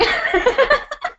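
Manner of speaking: a burst of high laughter; bright giggle at the joke. Real, not acted. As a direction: laugh it off lightly. Laughs at own parody.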